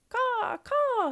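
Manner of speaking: high, loud crow cawing; long, piercing caws; crow call. A narrator's reading. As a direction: imitate a crow's caw. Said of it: "he said".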